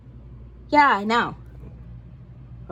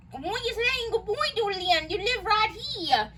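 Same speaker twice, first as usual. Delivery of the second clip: higher, teasing repeat; 7 semitones higher, 4 dB quieter than usual. Shown as a demonstration.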